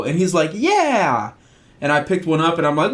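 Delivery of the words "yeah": put-on eager toddler. Toddler agreeing excitedly.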